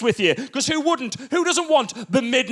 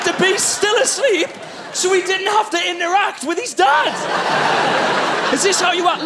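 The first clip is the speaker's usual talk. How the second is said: hammed-up disbelief at the baby; outraged dad; 10 semitones higher than usual. Acting out baby's fake sleep.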